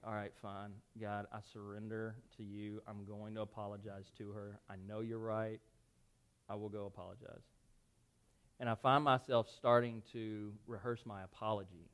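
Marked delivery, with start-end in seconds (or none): 0.0-7.4 low, hushed giving in; worn-down surrender. Retold or imitated. Man surrendering to God after months, low weary surrender. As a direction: concede softly, pitched down, all fight gone. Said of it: "finally just worn me down".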